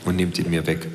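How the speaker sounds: dry low self-voice. Acted.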